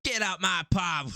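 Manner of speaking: slow, high, hammed-up Cockney accent; EastEnders Cockney. Parodying EastEnders to a co-host, mock Cockney. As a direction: draw it out like a Cockney soap landlady. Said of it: "Watching too much EastEnders".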